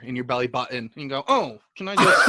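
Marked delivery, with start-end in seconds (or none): 1.3-2.3 slow, louder, put-on voice; mock polite request. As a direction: ask politely to use the sharpener. Acting out a pencil user.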